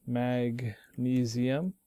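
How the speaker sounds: Magnesium drawn out slowly, every syllable clear; slow clear model word. Shown as a demonstration. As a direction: say Magnesium slowly so students can copy it.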